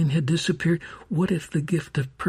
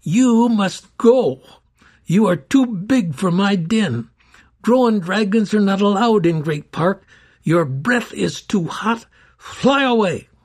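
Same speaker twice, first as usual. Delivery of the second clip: a princess facing down her dragon, majestic; majestic command; 5 semitones higher, 6 dB louder than usual. A narrator's reading. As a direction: order the beast away with regal authority. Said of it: "she announced in her most majestic tone".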